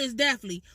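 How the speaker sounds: it's deathly slurred into "itz defly", no gap; run-together deathly. Shown as a demonstration. Showing viewers the run-together Chicago definitely. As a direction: blur it's deathly into one word, "iztefly". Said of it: "But when you say it together".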